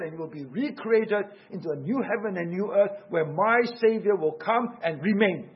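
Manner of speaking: ordinary delivery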